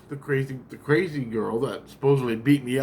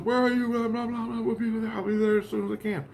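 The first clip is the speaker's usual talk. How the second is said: much higher, rushed brush-off; quick phone chatter; 11 semitones higher than usual. Retold or imitated. Caller and [name] arranging a visit, high and rushed. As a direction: rattle it off like phone small talk. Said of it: "He goes".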